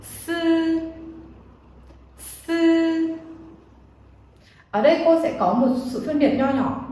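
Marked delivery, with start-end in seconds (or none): 0.0-3.2 "Sư" with the tongue flat and the air hissing out; model syllable. Shown as a demonstration. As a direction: demonstrate "Sư" twice for repetition. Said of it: "Các bạn đọc theo cô nào". (đọc mẫu "Sư" đầu lưỡi thẳng)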